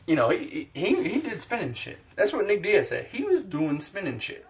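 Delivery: usual speaking voice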